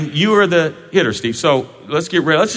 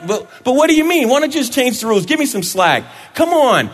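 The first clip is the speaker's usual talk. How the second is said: much higher, fast begging pitcher voice; pleading pitcher; 8 semitones higher than usual. Acted. Pitcher Brett begging umpire Anna for slack, higher pleading.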